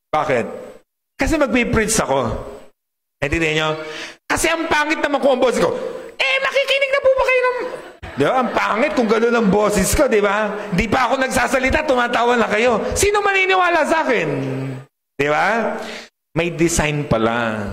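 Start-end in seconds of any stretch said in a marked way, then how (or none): 4.3-14.3 much higher, laughing squeaky voice; mock tiny voice. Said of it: "imagines preaching with a tiny voice". (maliit at matinis na boses na may tawa)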